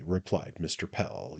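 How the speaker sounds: ordinary delivery, nothing marked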